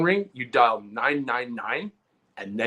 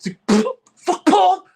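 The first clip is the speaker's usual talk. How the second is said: higher, louder imitated rage; mock furious; 6 dB louder than usual. Brother finding Vaseline on the phone, hammed-up angry outburst. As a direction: act out your brother losing it over a greasy doorknob.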